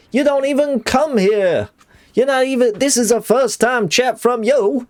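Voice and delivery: high, affronted New York tough talk; confrontational New York accent. Acted. New Yorker confronting a first-time chatter, affronted New York accent. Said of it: "Fine New York accent".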